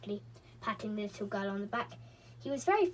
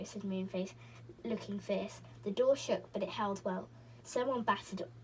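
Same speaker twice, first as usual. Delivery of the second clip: ordinary delivery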